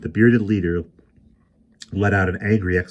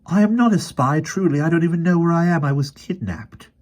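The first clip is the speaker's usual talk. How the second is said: put-on high, pleading boy's voice, close to panic; a scared child begging to be believed; frightened pleading boy; 12 semitones higher, 5 dB louder than usual. A narrator's reading.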